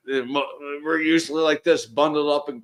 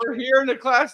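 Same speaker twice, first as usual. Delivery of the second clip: excited; 9 semitones higher than usual. Retold or imitated. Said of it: "we're like".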